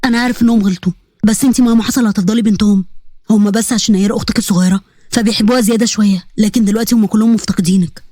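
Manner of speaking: low voice, gently pleading. Acted. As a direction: ask a favour like a loving uncle.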